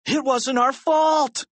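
put-on high, defensive boy's wail; high-pitched pleading protest; protesting cry. A narrator's reading. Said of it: "cried Harold".

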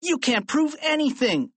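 put-on high, indignant kid's protest; defensive high-pitched objection; crying out. A narrator's reading. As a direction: protest the accusation, pitched right up. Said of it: "cried George".

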